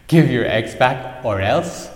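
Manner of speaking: loud, slow, scornful echo of a threat; mocking laughing ocean. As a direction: boom it out in mocking disbelief. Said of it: "started laughing and laughing and said".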